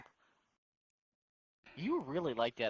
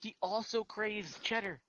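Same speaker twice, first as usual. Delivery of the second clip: slow, higher mocking tease; teasing; 8 semitones higher, slower than usual. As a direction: needle him with a sing-song jab. Teasing friend about the avatar.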